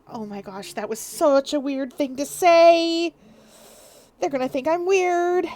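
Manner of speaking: slow, mock-worried self-talk; anxious inner voice. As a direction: act out a fretful thought. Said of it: "Even they are like".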